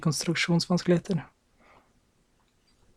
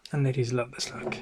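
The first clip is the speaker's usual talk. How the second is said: normal talking voice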